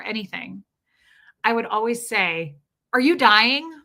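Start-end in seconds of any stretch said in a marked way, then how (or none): 2.9-3.7 loud, higher mock-alarmed question; teasing alarm. Woman teasing her partner whenever he coughs, mock-alarmed. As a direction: tease him with an exaggerated, rising question.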